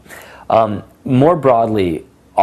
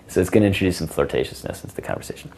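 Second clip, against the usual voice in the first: ordinary talk, nothing marked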